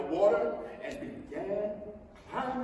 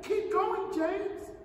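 high, eager call to James; cheering cry; 12 semitones higher, 4 dB louder, much slower than usual. Acted. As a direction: spur James on. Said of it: "they cried".

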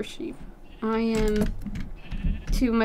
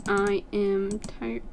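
sleepy, slowed-down admission; exhausted and slow; slow tired drawl; 3 semitones lower, 3 dB quieter, much slower than usual. Real, not acted. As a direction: drag it out slowly, worn out. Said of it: "if it wasn't obvious already".

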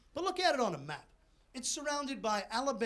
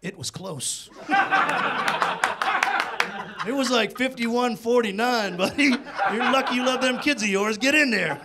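Saint Peter; 7 dB louder than usual. Retold or imitated. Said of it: "Saint Peter sitting there looking at me going".

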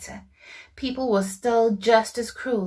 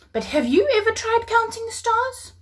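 high, playful voice for Abraham; 13 semitones higher than usual. Acted. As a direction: pipe it up with a twinkle. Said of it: "Abraham said".

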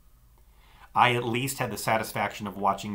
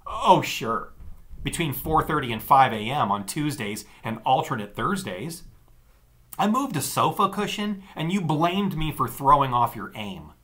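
sarcastic; 5 semitones higher, 6 dB louder than usual. A narrator's reading. Sister sarcastic retort.

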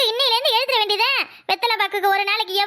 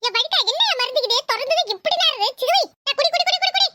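put-on higher kid voice, insisting; boy forcing grandpa to drink; pushy kid; 6 semitones higher than usual. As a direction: keep shoving the drink at him. Boy pours medicine in.

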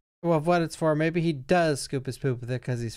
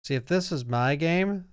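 ordinary talk

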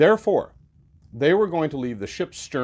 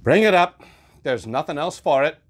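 resigned order; 4 semitones higher, 6 dB louder than usual. Acted. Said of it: "said Starbuck after a melancholy pause".

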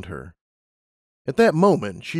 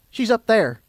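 high-pitched character voice; Twilight, informative; 11 semitones higher, 4 dB louder than usual. A narrator's reading. Twilight directing Lumina to the sun, high-pitched. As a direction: point it out in a raised pitch.

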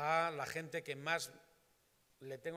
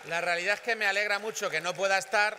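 much louder and higher, over the crowd; loud rally cry; 7 semitones higher, 16 dB louder than usual. Real, not acted. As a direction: shout it out to the supporters. Rally climax to cheering crowd. (grito de mitin, fuerte y agudo)

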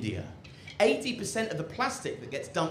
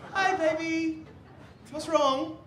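much higher, sweet fussing voice; doting partner; slower than usual. Acted. The cook's partner greeting them home, high and doting. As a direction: coo over them like a loving partner. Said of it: "they get back home from a hard day".

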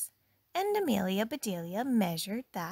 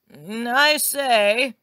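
loud, drawn-out call; 3 semitones higher, 12 dB louder, much slower than usual. A narrator's reading. Husband calls out about the towels, loud and drawn out. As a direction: call slowly to someone in another room. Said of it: "he called".

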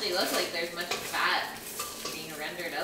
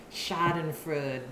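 usual speaking voice

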